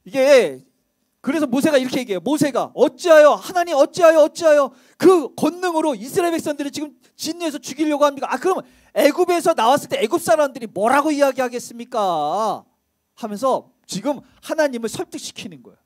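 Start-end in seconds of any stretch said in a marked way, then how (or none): 2.7-11.1 pleading cry. Acted. 하나님을 가로막는 모세, 크게 외치며 간청하듯. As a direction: cry out to stop him.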